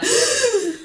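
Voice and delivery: sharp high mock gasp of horror; horrified gasp. Waking after the drunken night, mock horrified gasp. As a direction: gasp as if remembering last night.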